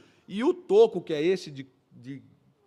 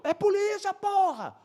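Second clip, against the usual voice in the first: very high, exasperated yell; acted insistent shout; 13 semitones higher, slower than usual. Plainclothes cop confirming to officer across the road, acted insistent shout. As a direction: shout back a forceful confirmation.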